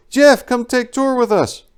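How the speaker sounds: put-on high, loud, slow accented girlish plea; girl soldier's invitation. Girl soldier invites Jeff on a tour, high, slow and loud. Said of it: "my personal favorite".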